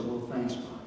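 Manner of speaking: put-on soft, weary thanks from a hurt old man; pained old father. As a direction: say it quietly through the pain. Injured old father answering his son.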